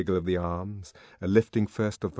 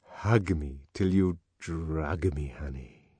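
slow, drawn-out coaxing, fading on the last word; seductive command; 5 semitones lower, slower than usual. A narrator's reading. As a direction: croon the words like a slow love spell. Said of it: "she commanded".

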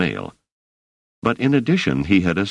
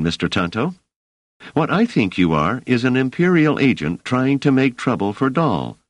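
lightly voiced young woman, firm and accusing; a hint of a stern young woman; Dors accusing; 6 semitones higher than usual. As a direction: call out the fake newsman, cool and firm. Narrator voices Dors confronting Tonto.

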